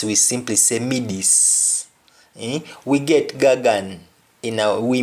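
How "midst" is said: "midis" with no final t; Patois midis. Shown as a demonstration. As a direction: drop the t: "midis". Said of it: "we don't pronounce the T".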